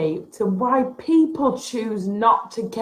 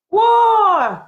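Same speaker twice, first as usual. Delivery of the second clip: loud, high exasperated whoa; sudden loud cry of exasperation; 8 semitones higher, 6 dB louder than usual. Real, not acted. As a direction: blurt it out in disbelief. Sudden outburst mid rant.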